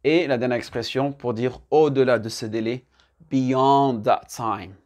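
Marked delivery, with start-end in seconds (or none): 3.3-4.7 very slow, "beyond" drawn out on its second syllable; slow repeat-after-me model. Shown as a demonstration. Teacher modelling a phrase to repeat, very slow. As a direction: stretch each word so learners can copy. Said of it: "bien répéter après moi".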